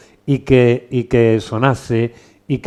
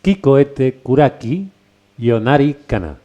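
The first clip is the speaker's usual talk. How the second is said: slow, higher, lilting Japanese verse; slow haiku recitation; 4 semitones higher, 5 dB louder, slower than usual. Shown as a demonstration. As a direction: recite the poem slowly and clearly. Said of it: "A ver, repítemelo otra vez".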